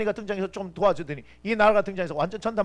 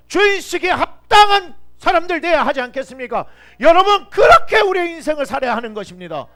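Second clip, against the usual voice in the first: loud rising sermon shout; preaching at full force; 8 semitones higher, 12 dB louder than usual. Real, not acted. Pastor shouting the call to his congregation, full-force preaching. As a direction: drive it home like a preacher at the peak.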